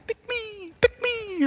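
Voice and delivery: high, thin repeated calls; distant kids. Acted. Mom keeps hearing kids all week, high distant calls. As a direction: pipe it up like faraway children. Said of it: "keeps on hearing this".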